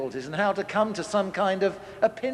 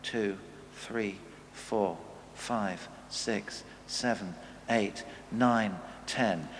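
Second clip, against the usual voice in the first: slow, much softer, lower calming count; calm count to ten; 8 semitones lower, 8 dB quieter, much slower than usual. Shown as a demonstration. Preacher showing parents how to calm down, slow soft count. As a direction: breathe through the count to cool down. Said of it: "what you do is you count to ten".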